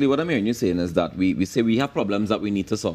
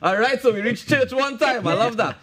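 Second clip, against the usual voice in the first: laughing; 14 semitones higher than usual. Real, not acted. Laughs at blessed joke.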